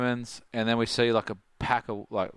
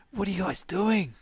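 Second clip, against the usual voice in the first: baffled question; 10 semitones higher than usual. Retold or imitated. Tom Carroll calls out.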